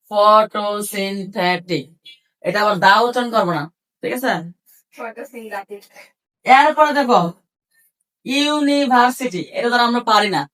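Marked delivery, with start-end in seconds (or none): 0.1-1.8 photosynthetic very slow, syllable by syllable; split into syllables. Shown as a demonstration. Teacher counts syllables with students, very slow broken photosynthetic. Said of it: "সিলেবলটাকে ভাগ করতে হবে". 8.2-9.4 university slow, syllable by syllable; broken into syllables. Shown as a demonstration. Teacher shows how to break a hard word, slow split university. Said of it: "আমরা কিভাবে ভাঙবো".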